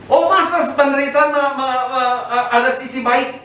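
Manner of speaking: high-pitched mock disbelief; incredulous objection. Doubter challenging that suffering has a good side, high incredulous voice.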